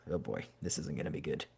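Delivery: a sinking private dread; muttered inner thought. Acted. Hearing who he faces, a low dreading mutter to himself.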